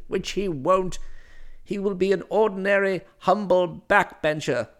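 stern domineering matron; formidable aunt voice. Acted. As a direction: lecture him like a severe aunt. Aunt-like candidate speaks.

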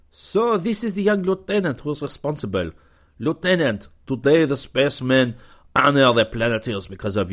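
gracious Frenchman welcoming a lieutenant; cordial French commander. A narrator's reading. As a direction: greet the young hero with courtly charm.

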